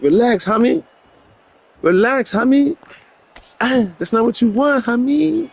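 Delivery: mock the opponent high and cocky; high taunting. Real, not acted. Gamer taunting the other boxer, high teasing voice.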